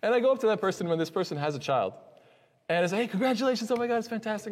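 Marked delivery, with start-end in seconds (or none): 3.0-4.5 high-pitched, gushing congratulation; acted-out delighted well-wishing; excited congratulations. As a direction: gush as if greeting a new parent. Congratulating a new parent in retelling.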